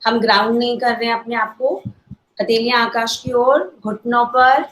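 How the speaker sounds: normal talking voice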